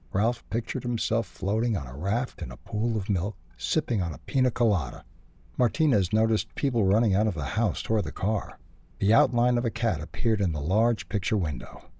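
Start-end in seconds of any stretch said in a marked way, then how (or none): none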